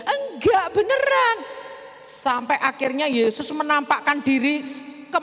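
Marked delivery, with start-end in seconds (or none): 0.1-1.4 pleading insistence. Acted. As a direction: insist desperately she's telling the truth. Insisting she's believed.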